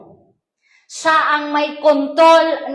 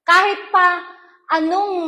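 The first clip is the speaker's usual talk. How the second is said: usual speaking voice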